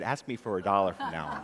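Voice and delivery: low, laughing mom; amused mom. Acted. Mom laughs as she hands her son a dollar. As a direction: say it through a laugh.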